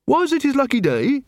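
high-pitched questioning voice; puzzled recruit. Acted. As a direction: ask in bafflement, pitched right up. Angua asks, puzzled.

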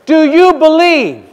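high, slow push on YOU BELIEVE; insistent challenge. Retold or imitated. Sceptic puts the believer on notice, insistent challenge.

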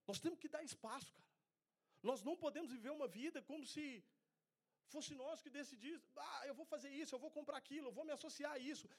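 fervent preacher building up; preaching at full force. Acted. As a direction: drive the point home to the church. Preaching to the congregation.

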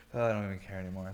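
ordinary talk, nothing marked

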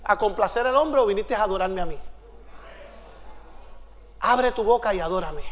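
a hint of a soft, low divine voice; lightly voiced, softly probing questions, then a command; Espíritu Santo. God questions the hesitant visitor, soft and low. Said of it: "el Espíritu Santo me dice".

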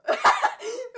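sudden loud laugh; burst of laughter. Real, not acted. Caught on mic saying swear.

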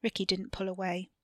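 ordinary delivery

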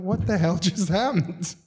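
high, slow baffled question; acted flabbergasted reaction; stunned disbelief. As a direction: stretch it out in mock shock.

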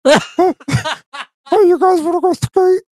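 very high, cartoonish stoked skater dude; frat bro. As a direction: pitch way up like a hyped little mushroom. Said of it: "how do you think that this dude would sound".

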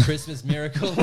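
normal talking voice

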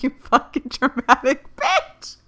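teasing "you dramatic" self-insult; mock self-scolding. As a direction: scold yourself with amused disbelief. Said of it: "Now I'm like".

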